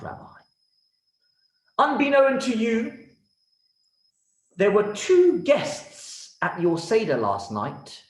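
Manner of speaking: a gracious king speaking from his throne; stately king's welcome, drawn out. Acted. King addresses the rabbi.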